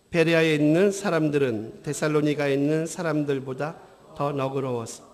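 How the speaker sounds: low, even, softened reading voice; scripture reading. Acted. Preacher reads Berea verse to congregation, scripture reading. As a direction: read the verse out soberly and evenly.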